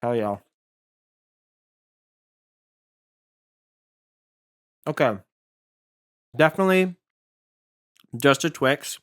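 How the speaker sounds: chewing behind a balaclava; muffled with mouth full; through a balaclava. Real, not acted. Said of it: "I'm sticking my lips out through the hole".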